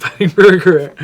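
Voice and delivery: cracking up at his own joke; amused laugh mid-sentence. Real, not acted.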